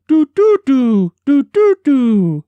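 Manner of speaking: high, loud piping bird cry, mimicked for grandmother; imitated bird call. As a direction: pipe it out like the bird he heard. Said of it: "was the way it sounded".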